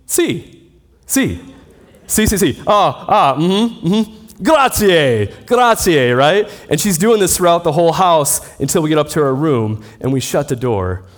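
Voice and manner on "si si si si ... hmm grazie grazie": high, bright nodding-along Italian yeses; eager bluffing agreement. Retold or imitated. Student bluffing to his Italian host mother, higher, eager. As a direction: fake confident agreement to a fast-talking host. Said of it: "And so I'm saying".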